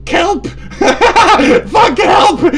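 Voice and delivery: very high, loud shriek cracking into laughter; shrieking, half-laughing distress call; mock scream for help. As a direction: scream "help" as a hammed-up victim. Comic cry for help.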